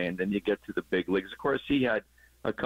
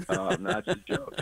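high, breaking into a laugh; amused, chuckling through the words; 12 semitones higher than usual. Real, not acted. Coach laughs off his answer about runners, laughing.

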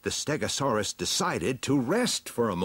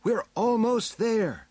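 high, drawn-out encouragement; high heroine coaxing a beast along; encouraging heroine; 14 semitones higher, 4 dB louder, slower than usual. A narrator's reading. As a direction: urge them on in a high, slow voice. Heroine urges the creature onward.

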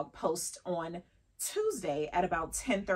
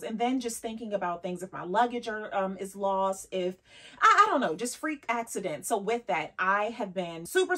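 usual speaking voice

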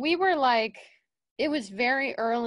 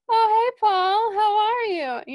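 very high, loud, gushing greeting; hammed-up excitable greeting; imitating Daryl; 11 semitones higher, 9 dB louder than usual. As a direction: mimic an over-friendly hello at full volume. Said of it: "you know how Daryl is".

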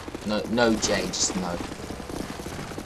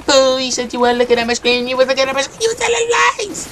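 high-pitched outrage, climbing higher; indignant accusation; 5 semitones higher than usual. Acted. Player accusing rival of lying about screen-looking, high-pitched outrage. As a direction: accuse them, rising to a squeal.

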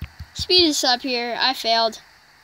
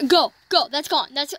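usual speaking voice

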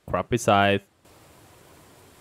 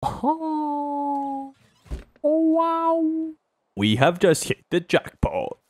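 1 excited; much slower than usual. Real, not acted. Finds treasure in the game.

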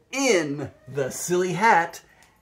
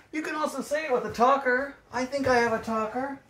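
much higher, fast and chirpy; light high patter; playful high voice; 7 semitones higher, faster than usual. Shown as a demonstration. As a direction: tease the kids like a game. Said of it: "playful aside about the talker".

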